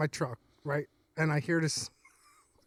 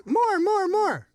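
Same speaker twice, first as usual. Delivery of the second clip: high-pitched toy begging for more; an excited toy voice, pitched way up; mock eager Elmo; 12 semitones higher, 4 dB louder, much slower than usual. Toy demanding more on the first try, mock eager. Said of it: "Like, the first time he goes".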